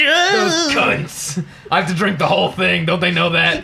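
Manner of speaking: high, hammed-up put-on voice; a hint of a silly character; Mort voice. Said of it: "Why does he sound like Mort?".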